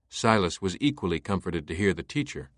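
ordinary delivery

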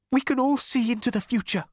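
high, fearful elevator voice, not whispered; terrified. A narrator's reading. Elevator revealing foresight to Zaphod, terrified. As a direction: confess it in high-pitched fear. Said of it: "whispered the elevator in what sounded like terror".

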